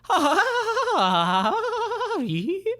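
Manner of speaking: high, loud cackling laugh; manic machine cackle. Acted. Geiger counter cackles at the mountaintop, high and loud. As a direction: cackle like a crazed gadget. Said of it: "noise coming out of the Geiger counter".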